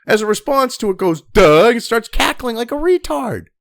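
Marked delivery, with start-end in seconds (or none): 1.2-1.7 loud dopey "Duh"; imitating a dim reply; mock dumb duh. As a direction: blurt it like a dim answer. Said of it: "And she goes".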